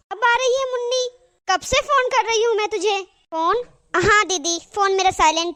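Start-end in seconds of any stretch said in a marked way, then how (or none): 0.1-3.0 higher-pitched irritated scolding of a late maid; annoyed scolding. Acted. Mistress scolds the late maid, annoyed. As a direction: snap at her for coming late. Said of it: "दीदी आप क्यों गुस्सा कर रहे हो".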